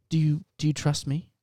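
ordinary delivery

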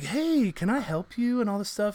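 sidle up like a helpful clerk; a mock shop clerk offering help; eager salesman. Eager self acting as salesman.

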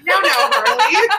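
laughing protest. Retold or imitated. Retold reply to Harley.

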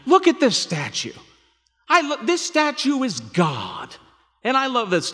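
high, loud mock-gullible voice; hammed-up, loud and high statue worshipper; mock idol worshipper. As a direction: pitch it way up, mocking naive awe. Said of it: "they weren't so stupid as to going".

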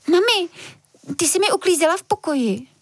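put-on high child's voice, alarmed; a girl realising her bag is gone; alarmed little girl. Girl alarmed, asks mother. (vysoký, polekaný dívčí hlas)

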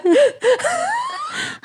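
crying with laughter; high wheezing laugh. Real, not acted. Laughing fit continues.

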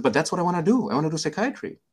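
high-pitched, eager retold protest; eager insistence. Student telling the senior his chosen field, eagerly insistent.

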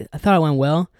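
ordinary talk, nothing marked

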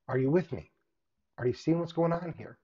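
usual speaking voice

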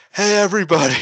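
high mock call to a crowd. Wrestler hailing the autograph queue, imitated high call. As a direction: pitch it way up, as if hailing the whole queue. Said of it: "she just gets up and is like".

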